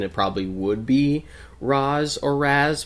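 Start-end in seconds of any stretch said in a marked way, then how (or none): 1.6-2.8 Raz first as "rahz", then with a flatter a as "razz"; two takes of Raz, open ah then short a, both ending in z; Arabic-style variants. Shown as a demonstration. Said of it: "going by a proper Arabic pronunciation".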